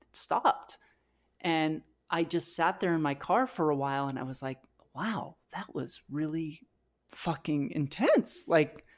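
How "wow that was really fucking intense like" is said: normal talking voice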